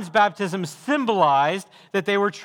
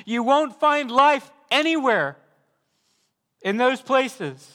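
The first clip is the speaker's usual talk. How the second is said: higher, louder pulpit warning; preaching at full force; 7 semitones higher, 4 dB louder than usual. Acted. Preacher warning hearers away from false hopes, forceful preaching. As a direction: hammer each won't find home.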